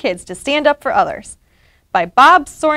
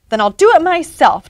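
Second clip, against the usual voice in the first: higher-pitched taunt; 5 semitones higher, 4 dB louder than usual. A narrator's reading. Pete daring reluctant Ralph, higher taunting voice. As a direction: throw it out as a schoolyard taunt. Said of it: "taunted Pete".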